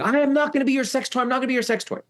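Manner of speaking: high, fast re-enacted protest; retold screaming. Teller runs around the house protesting, retold screaming. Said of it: "I'm running around the house screaming".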